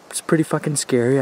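normal talking voice

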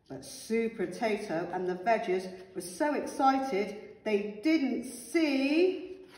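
plain reading voice

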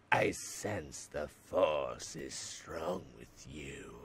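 low, slow, soft Sith master; Sith lord voice. Acted. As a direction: intone it darkly, slowly.

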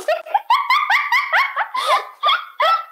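shrill burst of laughter; high squealing laugh. Real, not acted. Laughs at the insult.

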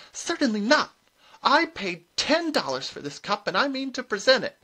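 high, loud indignant refusal; huffy, high-voiced insistence. A narrator's reading. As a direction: refuse point-blank, pitched high and loud. Contestant refuses to go home.

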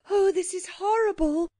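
put-on high-pitched child's distress; horrified cry. A narrator's reading. Girl watches the volcano's destruction, crying out in horror. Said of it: "cried Anthea".

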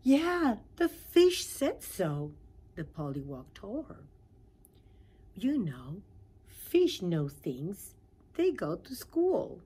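little tadpole showing off; proud pollywog. Acted. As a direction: explain it like a proud child.

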